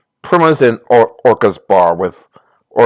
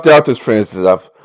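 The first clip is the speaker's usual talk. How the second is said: normal talking voice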